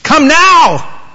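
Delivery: high, loud urgent summons; urgent call. Acted. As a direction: call it out like it can't wait. Acted urgent call in preaching.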